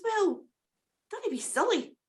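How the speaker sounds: high, chirpy little bird voice, grateful; a small rescued bird thanking its saviour; wee cuckoo voice. Acted. Cuckoo thanks Jack for saving its life, high grateful bird voice.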